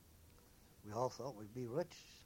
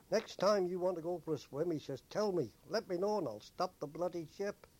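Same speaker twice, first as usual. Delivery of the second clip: higher, louder sarcastic scolding; captain's mock telling-off; 5 semitones higher, 5 dB louder than usual. Captain shouting down from the bridge, mock angry. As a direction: bellow it down like a fed-up captain. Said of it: "shouted down".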